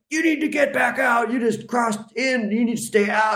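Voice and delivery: high, mock shouted order to stay out; imitating stern police. Police officer ordering her back out the door, mock shout. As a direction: bark it like a guard turning you back. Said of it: "they're like".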